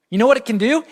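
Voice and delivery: ordinary delivery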